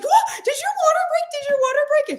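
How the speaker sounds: very high, shrill-rising disbelief; shocked mom. Retold or imitated. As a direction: squeal it like a stunned mom. Mom's shocked reaction.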